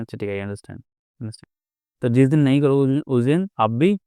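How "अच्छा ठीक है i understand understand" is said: quiet accepting murmur; calm soft self-talk. Shown as a demonstration. Showing how to answer a provoker, soft calm murmur. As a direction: say it gently to yourself. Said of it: "अभी बोलोगे".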